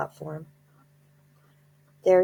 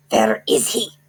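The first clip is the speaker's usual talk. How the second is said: higher, louder sharp witch voice; witch snapping; 7 semitones higher, 8 dB louder than usual. A narrator's reading. Grand High Witch snapping as she searches the platform. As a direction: snap it like an angry witch. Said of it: "snapped the Grand High Witch".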